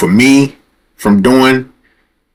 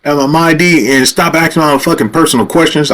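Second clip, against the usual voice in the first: raised-pitch, fed-up retort; snapping; 7 semitones higher than usual. Retold or imitated. Driver fed up with officer's personal questions, snapping. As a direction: retell losing patience in one run. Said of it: "I just snapped".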